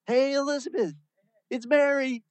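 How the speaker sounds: high, loud bright hello; Mary calling out to Elizabeth at the door, high and cheery; cheerful call. Retold or imitated.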